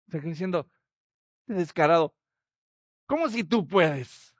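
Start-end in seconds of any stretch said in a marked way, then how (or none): 1.5-4.3 indignant Jesus. Acted. Jesus scolding the doubting father, indignant. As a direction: throw his doubt back at him.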